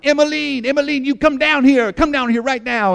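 high, loud imitated evangelist calling a name; mock healer calling out. Pastor imitates a TV healer summoning a woman, high and loud. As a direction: call her down like a showman healer.

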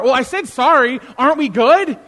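hammed-up quarrel reply; acted defensive protest. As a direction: push back, mock baffled and defensive. Acted quarrel, the apologizer answering.

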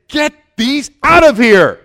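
loud, high, furious command; Jesus thundering at the dove sellers, angry shout; Jesus' angry shout. Acted. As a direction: drive them out with fury. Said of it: "he said".

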